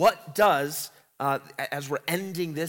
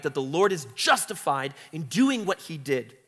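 ordinary talk

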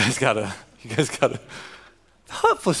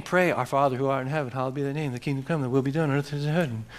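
speed-recited prayer; 4 dB quieter, much faster than usual. Shown as a demonstration. Pastor showing how people race through the prayer, fast gabble. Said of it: "I always have a competition who could say it faster".

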